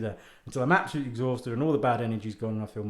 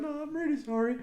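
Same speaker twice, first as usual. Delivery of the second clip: apologetic lady; 9 semitones higher than usual. Retold or imitated. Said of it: "she's going".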